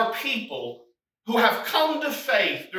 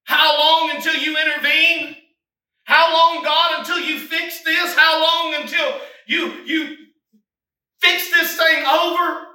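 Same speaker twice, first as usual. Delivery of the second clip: higher, louder pleading How long; pleading cry; 6 semitones higher, 5 dB louder than usual. Acted. Preacher voicing the listener crying to God, loud pleading. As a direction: press God for an answer. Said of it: "Have you ever asked that question before?".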